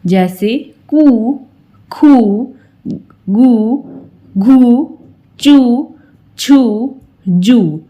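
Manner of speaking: short उ ending on कू खू गू; short u drill. Shown as a demonstration. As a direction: clip the u in कू short. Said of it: "इस तरीके से लगाते हैं". (छोटे उ की ध्वनि के साथ बोलना)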